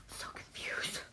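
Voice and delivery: whispered aside. Real, not acted. Teacher whispering to her class. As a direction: drop to a breathy secret.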